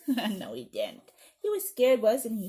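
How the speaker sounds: usual speaking voice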